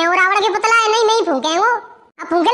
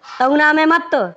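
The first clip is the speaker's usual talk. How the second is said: Ravan; 3 semitones lower than usual. Acted. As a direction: proclaim it like a stage villain. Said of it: "Ravan हूँ मैं".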